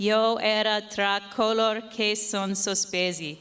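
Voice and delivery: ordinary talk, nothing marked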